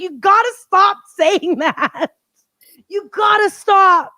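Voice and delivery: slow, loud pleading, half laughing; exasperated plea. Retold or imitated. Sister begging younger one to stop boasting, mock exasperation. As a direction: stretch it out like a fed-up older sister.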